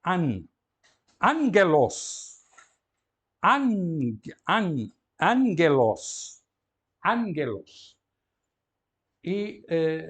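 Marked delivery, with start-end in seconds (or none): 0.0-8.0 "angelos" slowly, first gamma as a held n. Shown as a demonstration. Teacher showing the double gamma, slow drawn-out "an-gelos". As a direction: split out "an", then a hard g in "angelos". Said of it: "vai ser lido como um N".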